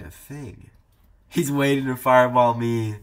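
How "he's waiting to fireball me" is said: loud and higher, thrilled; excited. Real, not acted. Spotting the waiting opponent, loud and excited. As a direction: blurt it out loud and keyed up. Excited close call in game.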